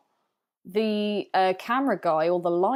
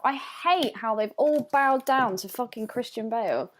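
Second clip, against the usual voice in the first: ordinary talk, nothing marked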